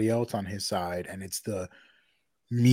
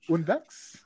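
mock German; 6 semitones higher, 3 dB quieter than usual.

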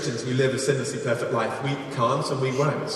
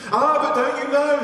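high-pitched storyteller's reproach; mock admonishing; 11 semitones higher, 5 dB louder than usual. Villager warning a porcupine hunter, mock admonishing. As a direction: scold the rule-breaker in a raised pitch. Repeated warning acted in fable.

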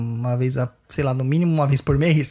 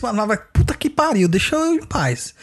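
angry outburst; 6 semitones higher than usual. Retold or imitated. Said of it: "Eu viro assim".

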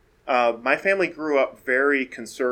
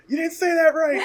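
high-pitched mimicked voice chiming in; correcting background voice; 4 dB louder than usual. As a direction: pipe up high, imitating someone correcting you. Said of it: "I'll hear this voice in the background".

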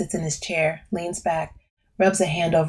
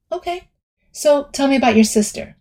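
therapist voice; 9 semitones higher, 7 dB louder than usual. Acted.

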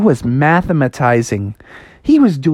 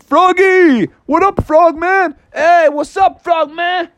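high-pitched gang of pals; rowdy cartoon kid greeting; Froggy's buddies; 13 semitones higher than usual. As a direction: greet your friend with high, excited hollers.

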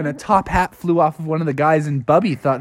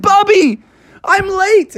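very high, louder startled voice; flustered groundhog; 5 dB louder, slower than usual. Acted. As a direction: fluster like a late cartoon groundhog. Phil calls Bubby.

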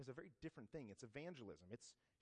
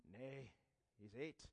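put-on Scottish accent; 3 semitones lower, 5 dB quieter, slower than usual. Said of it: "Excuse my terrible Scottish accent".

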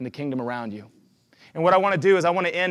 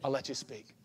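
softer, hushed plea to God; lowered voice asking the Lord; quiet prayer; 4 semitones lower, 5 dB quieter than usual. Retold or imitated. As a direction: ask gently and let it fade. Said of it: "and I say".

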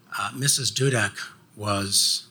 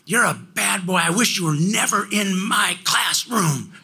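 higher, louder mock scolding of a bad boy; teacher scolding; 7 semitones higher, 3 dB louder than usual. Missus Dudek scolding Tom in the hallway, raised mock scold. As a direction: hammer it home like a cruel teacher. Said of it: "And she would say things like".

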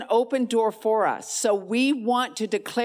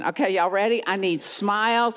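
ordinary delivery

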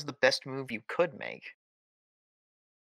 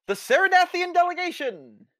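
pompous fussy courtier; 3 dB louder than usual. Acted. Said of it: "My, uh, my apologies".

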